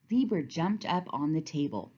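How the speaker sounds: plain reading voice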